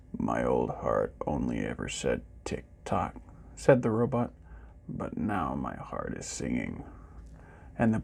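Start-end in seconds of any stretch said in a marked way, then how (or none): 0.1-3.1 much lower, softer robot voice, a broken heart in it; deep, quiet, forlorn robot; sad robot. A narrator's reading. Robot speaks in the story. 4.9-6.8 much lower, softer robot voice, a broken heart in it; deep, quiet, forlorn robot; sad robot. A narrator's reading. Robot speaks in the story.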